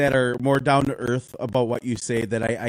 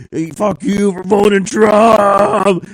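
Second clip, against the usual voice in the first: loud, slow, higher angry shout; imitated shout; 5 semitones higher, 6 dB louder, much slower than usual. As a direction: yell it slowly at the crowd. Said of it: "he's like".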